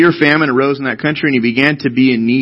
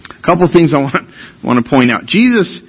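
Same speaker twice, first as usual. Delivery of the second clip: ordinary talk, nothing marked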